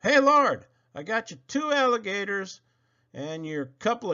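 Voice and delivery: high, loud shouted hail; loud high call upward. Acted. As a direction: call up to the sky. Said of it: "he called out as they went through".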